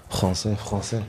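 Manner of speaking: practising a throaty French r; French accent. Shown as a demonstration. Warming up for the challenge, French accent.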